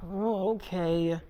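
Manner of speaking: soft, slow retold okay after a sigh; sigh, then give in slowly and quietly; imitating a let-down partner giving in; reluctant acceptance. Reluctantly letting him leave, sighs first.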